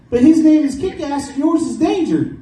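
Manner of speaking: high, prissy front-desk lady voice; prim school receptionist. Retold or imitated. School lady questioning the stepdad, high prim voice.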